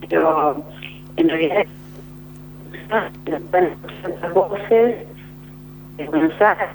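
choppy line, words cutting out; breaking up. Real, not acted. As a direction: voice dropping out over the phone. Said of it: "Se volvió a entrecortar".

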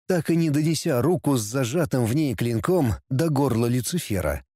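usual speaking voice

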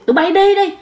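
high retold angry yell; stubborn old man shouting at daughter and son-in-law, high and angry; grandfather chasing them off. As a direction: drive them off the porch, pitch up. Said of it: "Cầm cái cây đứng kêu đuổi".

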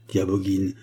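normal talking voice

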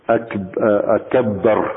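أكبر said slowly with a doubled, stressed ba. Shown as a demonstration. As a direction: lean hard on a doubled باء in أكبر, slowly. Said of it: "وألا يشدد الباء". (أكبر ببطء مع تشديد الباء)

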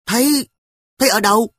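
put-on high, loud little girl's disbelief; a kid not buying it, pushing back; doubtful. Sister to brother, high disbelieving girl voice. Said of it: "giọng không tin".

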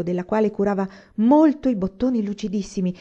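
ordinary delivery

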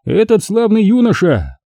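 high-pitched ringing announcement; thunderous proclamation. A narrator's reading. Magister presenting the victor to the knights, proclaiming grandly. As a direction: proclaim the hero to the hall. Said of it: "громовым голосом закончил".

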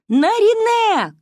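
high, loud drawn-out call of the name; distant desperate call. A narrator's reading. A voice calls the girl from far away in the forest, a high, loud call. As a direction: call out across the dark forest. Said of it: "крик раздался".

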